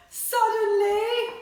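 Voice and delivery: high, loud, drawn-out suddenly; big suspenseful reveal; dramatic suddenly. Acted. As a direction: make the kids jump on it. Dramatic story word.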